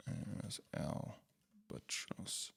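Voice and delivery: whispered slowly under his breath. Real, not acted. Vlogger whispering a base name to himself while searching.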